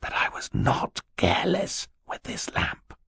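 urgent hiss under the breath; hissed whisper. A narrator's reading. Boy defending himself to his sister over the lamp, hissed whisper. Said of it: "Klaus hissed to his sister".

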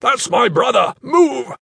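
high, loud desperate shout. Acted. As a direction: force the crowd aside. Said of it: "he roared".

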